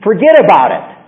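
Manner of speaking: sudden loud reassurance; emphatic dismissal. Real, not acted. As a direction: wave the fear away with force. Reassuring worried listeners emphatically.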